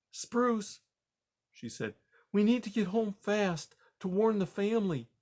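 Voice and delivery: puzzled but kind; gentle grandma mouse. Acted. Grandma Poppy.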